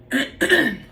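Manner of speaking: a sharp throat clear. Real, not acted. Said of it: "got a frog in my throat".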